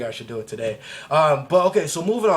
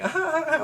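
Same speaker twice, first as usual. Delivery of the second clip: high squealing ah ah ah; mock happy screams. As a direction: squeal like a giddy kid skipping. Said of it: "and I'm screaming".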